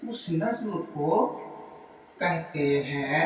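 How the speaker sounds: slow, soft word-by-word dictation with pauses. Shown as a demonstration. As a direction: dictate each phrase for students to write down. Dictates second answer. (धीमे-धीमे, रुक-रुक कर लिखवाना)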